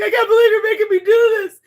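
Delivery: high, loud, fast mock wail; imitated crying. Uncle digging up the dog for his niece, imitated tearful wail. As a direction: blurt it as a crying uncle's complaint. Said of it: "he was crying".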